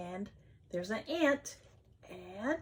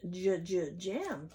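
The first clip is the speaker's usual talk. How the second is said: normal talking voice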